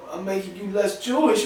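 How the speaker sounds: ordinary talk, nothing marked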